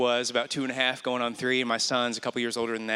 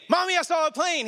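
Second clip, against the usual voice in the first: put-on high little-boy voice; excited toddler calling out; child voice. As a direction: pipe it up like a small boy. Son voiced in retold story.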